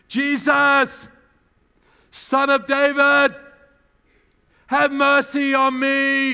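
loud, slow, high desperate cry; shouting out. Retold or imitated. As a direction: cry out to Jesus across the crowd. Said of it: "he starts to shout out".

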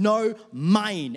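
loud, high mock-toddler protest; passionate, possessive. Father acts out toddler refusing to share grapes, passionate and possessive. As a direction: imitate a stubborn little kid refusing. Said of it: "she says it with such passion and ownership".

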